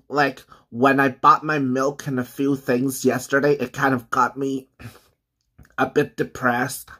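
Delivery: talking around bites of crust; chewing while talking. Real, not acted. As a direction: mouth partly full of food. Seen 2: Bites and chews breadstick crust at mouth throughout the labelled words.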